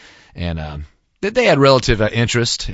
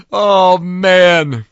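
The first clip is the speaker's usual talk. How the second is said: ordinary talk, nothing marked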